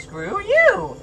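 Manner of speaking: high, loud playful jab; mock defiance. Streamer answers critics with a loud mock snub. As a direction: fire it back at the doubters.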